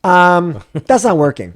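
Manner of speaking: hammed-up wife shutting him down flatly; wife cutting in, loud and dismissive; mock blunt wife. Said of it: "and my wife's like".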